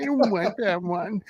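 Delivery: imitating a childish whine; mock whine, rising at the end; whiny voice. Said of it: "like, the little whiny voice there".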